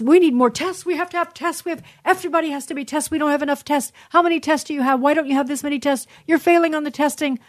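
mocking sing-song of the testing demands; mocking mantra. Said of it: "the mantra now is".

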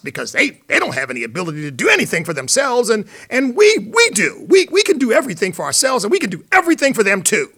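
loud, high, overexcited mock speech; worked-up, hammed-up crusader; mock fervor. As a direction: pitch it up and loud, gushing with zeal. Mocking impression of opponents' speech.

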